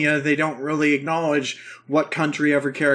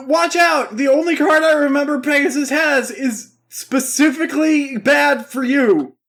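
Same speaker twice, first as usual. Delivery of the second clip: raised, loud voice of urgent alarm; mock Yugi warning; 9 semitones higher, 3 dB louder than usual. Recapper voices Yugi warning Kaiba, mock-urgent. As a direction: imitate a frantic warning, drawing out the end. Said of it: "Yugi's all like".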